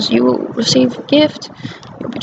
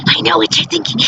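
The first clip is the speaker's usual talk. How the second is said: imitating the scary mother; 6 semitones higher than usual. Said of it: "I can't even do it right".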